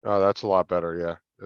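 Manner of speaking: ordinary talk, nothing marked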